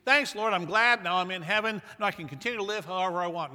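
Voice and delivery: breezy, loud, rushed voice of a careless believer; mock careless believer. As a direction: toss it off like a shallow convert.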